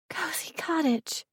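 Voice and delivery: put-on high, slow whisper of a little girl; hushed child's voice. A narrator's reading. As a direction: whisper it in wonder like a small child. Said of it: "Danielle's voice dropped to a hush".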